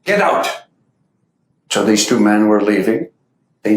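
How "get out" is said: firm raised order; stern command. Retold or imitated. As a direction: bark it once more, curt and final. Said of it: "in his Adi K Rani voice said".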